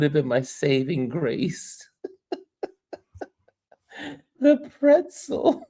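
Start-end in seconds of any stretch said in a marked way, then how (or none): 4.4-5.7 high, slow "The pretzel"; mock-wistful. Real, not acted. Host fondly naming the pretzel, high and slow. Laughing aside about pretzel.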